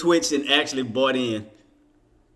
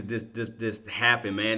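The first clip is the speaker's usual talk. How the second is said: ordinary talk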